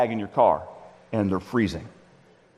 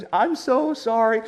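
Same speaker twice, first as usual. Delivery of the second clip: high, slow, drawn-out apology; apologetic; 7 semitones higher, slower than usual. Acted. Woman returning grandpa's cookies, acted apology. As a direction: plead it high and slowly.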